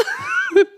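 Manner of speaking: a short high laugh at her own joke; bright burst of laughter; real laugh. Real, not acted. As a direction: break into a quick, high laugh.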